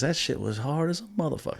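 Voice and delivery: high, put-on [name] voice full of praise; [name] impression. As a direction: imitate [name] raving about the rap. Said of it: "And he goes".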